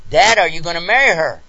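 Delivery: put-on loud, excited little girl's question; retold child's loud question; little daughter. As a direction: blurt it out loudly like a kid. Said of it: "the same little daughter".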